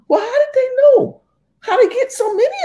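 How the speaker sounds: very high, loud squeal of disbelief; high falsetto panicked owner; mock Becky. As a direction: squeal the question in shock. Owner voiced in story.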